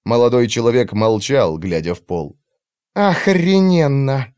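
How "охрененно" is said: slow, seething hiss; furious hiss. Acted. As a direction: spit it out in rage. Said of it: "в ярости прошипела учёная". (яростное шипение)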